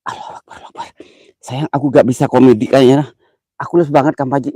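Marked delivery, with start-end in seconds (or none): 0.1-0.9 whispered prayer, barely voiced; frightened whisper. Real, not acted. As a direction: breathe the takbir out in fear. (bisikan doa ketakutan)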